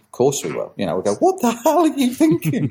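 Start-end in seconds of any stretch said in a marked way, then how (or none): 1.2-2.7 high-pitched mock outrage; teasing, squeaky-high incredulity; mickey-taking disbelief. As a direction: take the mickey out of a daft idea, pitched right up. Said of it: "we will take the mickey".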